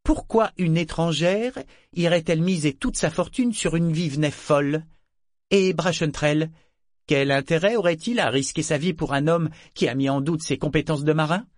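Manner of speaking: sceptical. A narrator's reading. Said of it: "si j'ai l'air si sceptique".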